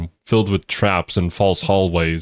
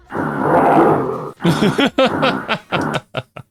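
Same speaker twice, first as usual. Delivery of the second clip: high laugh; 10 semitones higher than usual. Real, not acted. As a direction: burst of high-pitched laughter. Cohost laughs at video clip.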